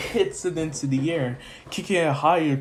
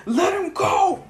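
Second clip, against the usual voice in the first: much higher battle shout; heroic yell; 3 dB louder, slower than usual. Retold or imitated. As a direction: demand it with full force. Zuko attacks the villain.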